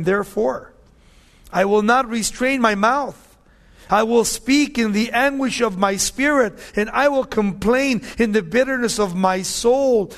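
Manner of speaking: slowing, weighty scripture read. Acted. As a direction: proclaim the verse from the pulpit. Reads Job's lament.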